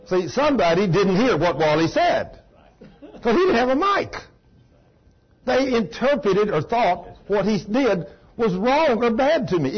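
usual speaking voice

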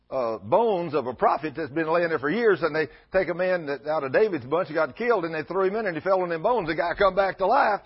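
loud, high, full-force preaching to the congregation; fiery preaching. Acted. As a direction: thunder it out like a revival preacher.

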